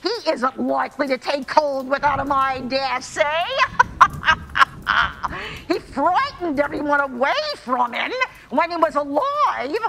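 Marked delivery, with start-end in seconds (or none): 3.5-5.6 cackle. Acted. Charwoman gloating over stolen blankets.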